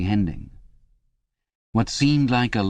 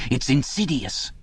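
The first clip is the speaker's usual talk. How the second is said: much higher, eager, anxious chap's voice; a frightened man begging for reassurance, pitched up high; Parsons, nervous and pleading; 10 semitones higher than usual. Acted. Parsons begging Winston in the cell, high anxious pleading.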